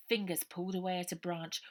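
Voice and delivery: ordinary delivery, nothing marked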